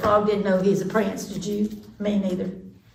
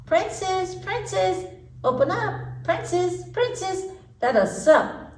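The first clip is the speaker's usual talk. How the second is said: high sing-song frog call; high frog calling at the door; 10 semitones higher, slower than usual. Acted. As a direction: coax her to open up. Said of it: "somebody called".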